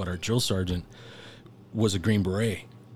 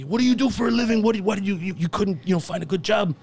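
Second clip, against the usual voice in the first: put-on Ecuadorian accent; 11 semitones higher, 5 dB louder than usual. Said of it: "he had a real thick, uh, Ecuadorian accent".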